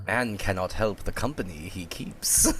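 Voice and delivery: low, quiet, solemn mystic; Jaqen impression. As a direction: intone it in a hushed low third-person voice. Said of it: "Fucking Yoda over here".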